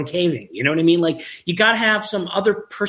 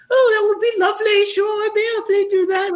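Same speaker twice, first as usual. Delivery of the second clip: very high-pitched British impression; Gina Mosley British accent; 3 dB louder than usual. Scientist happily accepts the filmmaker's invitation in an imitated British accent. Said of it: "she's British. She's got this great accent".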